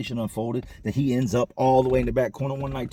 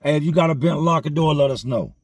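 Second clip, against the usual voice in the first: loud announcement call, higher; 4 semitones higher, 6 dB louder than usual. Retold or imitated. Maintenance worker calling out to the inmates, loud announcement. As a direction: call it out across the room.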